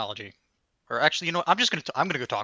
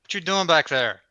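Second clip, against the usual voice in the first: louder, calling across the lot; hollering; 7 dB louder than usual. Acted. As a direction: holler at him from a distance. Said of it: "I'm just hollering at him".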